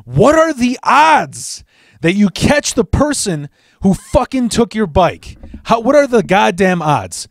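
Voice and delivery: high, slow, emphatic disbelief; incredulous outburst. Retold or imitated. Storyteller on catching his bike thief, incredulous. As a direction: stretch it out, voice leaping up in amazement.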